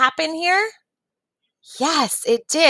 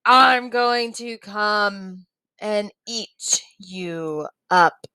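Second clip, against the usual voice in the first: slow, drawn-out troll threat; teacher's slow storybook troll; much slower than usual. Acted. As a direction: stretch it out menacingly, word by word. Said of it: "the troll says".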